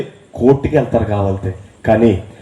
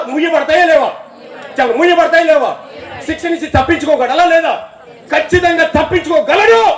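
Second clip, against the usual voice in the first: high, impassioned preaching; fervent preaching; 13 semitones higher than usual. Acted. Preaching at full force.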